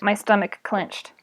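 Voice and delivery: ordinary delivery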